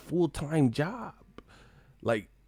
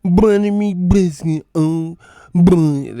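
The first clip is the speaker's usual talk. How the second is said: loud, slow mumbled cartoon voice; mock them like a droopy cartoon dog; hammed-up mumbling cartoon imitation; Droopy Dog mockery; 4 semitones higher, 10 dB louder, much slower than usual. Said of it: "They sound like that".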